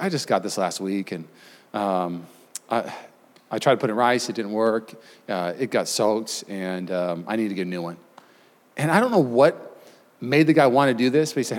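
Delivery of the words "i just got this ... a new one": much softer, sheepish mumble; sheepish plea. Retold or imitated. Telling the Apple guys his phone got soaked, soft and sheepish. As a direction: confess it quietly, hoping for mercy. Sheepishly pleading at Genius Bar.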